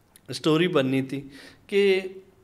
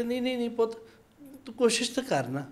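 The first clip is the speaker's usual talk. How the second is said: higher pitched mock plea of an old mother; imitating pleading old mother; 10 semitones higher, faster than usual. Narrator imitates the Sikh mother begging him, higher pitched pleading. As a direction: echo the old woman urging him to try, voiced up high. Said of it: "وہ کہہ رہے".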